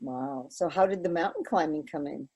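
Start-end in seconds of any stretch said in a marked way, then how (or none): none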